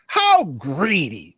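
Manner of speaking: drawn-out, hammed-up British reproach; mock British accent. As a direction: put on a British accent, slowly stretching it. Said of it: "in my British accent".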